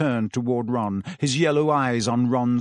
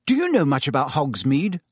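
ordinary delivery, nothing marked

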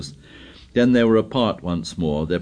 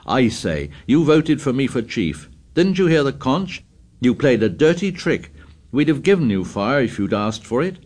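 heated, raised accusing voice; angry; 5 semitones higher than usual. Acted. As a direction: let the temper blaze. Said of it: "Ralph's temper blazed out".

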